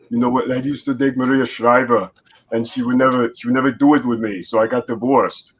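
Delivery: Austrian-accented action-star impression; Schwarzenegger. Voice actor showing off for the host with an action-hero impression. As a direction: do the Terminator star's heavy accent, punching the commands.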